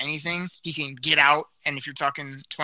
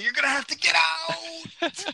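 high, slow shouted call-out; shouting; slower than usual. Acted. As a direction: yell it out slowly. Get out catchphrase shout.

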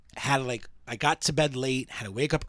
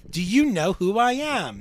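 high, slow, self-important outrage; hammed-up diva demanding recognition; mock indignation; 8 semitones higher, 4 dB louder than usual. Playing the camera pulling rank, mock indignant.

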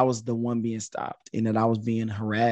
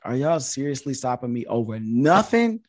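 retold exasperation, climbing as it goes; annoyed and irritated; 5 semitones higher than usual. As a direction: push back, annoyed and incredulous. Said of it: "annoyed and irritated".

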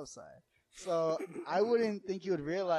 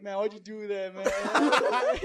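laughing; 5 semitones higher, 13 dB louder, much slower than usual. Retold or imitated. Cousin complaining, laughing.

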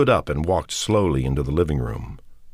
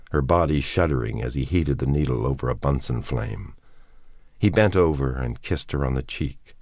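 ordinary delivery, nothing marked

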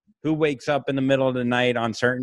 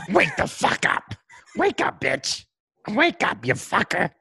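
higher, hoarse yelling infant voice; bully them awake like a tiny tyrant; mock baby demand; 4 semitones higher than usual. Dan's kid demanding parents get up, hammed-up. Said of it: "and then go".

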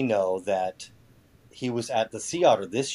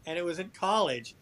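defensive; 9 semitones higher, 3 dB louder than usual. Pretend. Defensive protest, jokingly.

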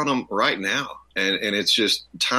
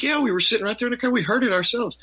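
much higher, fast voicing of the friends; high quick retold reply; 12 semitones higher, faster than usual. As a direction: rattle it off high and eager. Friends voiced in retold exchange.

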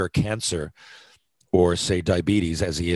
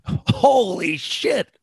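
mock stunned; 11 semitones higher, 4 dB louder, much slower than usual. Reacting to the announcer.

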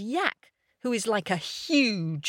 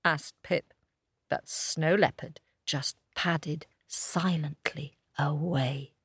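usual speaking voice

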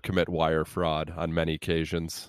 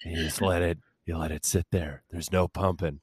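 softer mock-instructor voice, trailing down; put-on hushed voice; 3 semitones lower, 6 dB quieter than usual. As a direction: keep the voice down while explaining. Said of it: "I'll do it in this voice".

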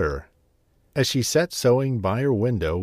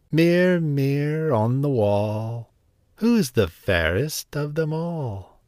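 vain queen; 4 semitones higher, slower than usual. Acted. Queen asks magic mirror.

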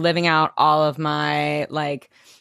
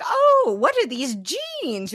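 high gushing mock wonder; teasing performer; 9 semitones higher, slower than usual. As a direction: coo over the jeans.

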